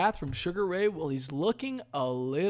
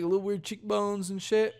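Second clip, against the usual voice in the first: ordinary talk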